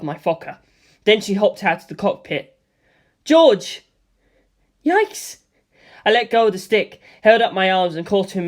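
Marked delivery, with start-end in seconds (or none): 3.2-3.8 loud, high call across the sky. A narrator's reading. Lisa calls from her plane. 4.9-5.4 much higher, sudden yikes; startled yelp. A narrator's reading. Startled as she jumps.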